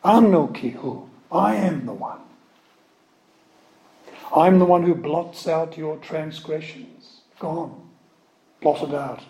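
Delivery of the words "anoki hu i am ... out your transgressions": raised, solemn proclaiming of scripture; scripture reading. Acted. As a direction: declaim it like a prophet before a congregation. Reading Isaiah with emphasis.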